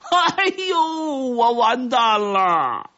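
long, high drawn-out wail; agonized scream. A narrator's reading. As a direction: shriek in despair, stretching the words. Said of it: "就惨叫了一声". (拖长声音凄厉惨叫)

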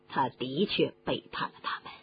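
ordinary delivery, nothing marked

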